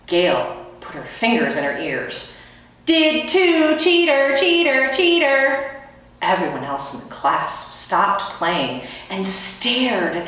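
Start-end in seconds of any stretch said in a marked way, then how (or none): 2.8-5.4 high, loud sing-song chant; sing-song taunt. Acted. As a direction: taunt her over and over. Girl taunting with fingers in ears.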